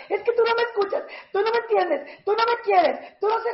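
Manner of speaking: shrill-pitched accusations, the wrong way; high whiny complaint. Shown as a demonstration. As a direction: whine each tú no me high. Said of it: "Pero te agarras".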